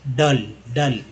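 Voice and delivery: ordinary talk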